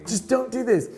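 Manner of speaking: mock exasperation. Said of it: "I'm like".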